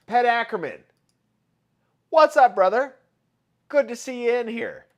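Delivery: high, loud welcome to a friend; hyped shout-out to a viewer; loud greeting. Real, not acted. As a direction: call out a hello to a buddy.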